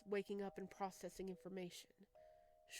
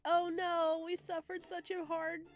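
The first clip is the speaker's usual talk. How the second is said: mock dramatic wail; 10 semitones higher, 8 dB louder, slower than usual. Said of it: "we're like".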